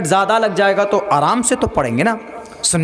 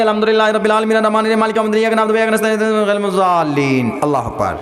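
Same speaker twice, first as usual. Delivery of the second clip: gabbled Quran recitation on one note; mock rushed recitation; faster than usual. Preacher mimicking hurried Taraweeh prayer, rapid monotone gabble. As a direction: race through it like a hurried hafiz. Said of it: "کیا ہے یہ؟ سمجھ میں ہی نہیں آ رہا۔".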